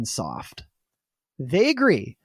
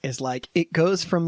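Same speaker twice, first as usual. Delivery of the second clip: ordinary delivery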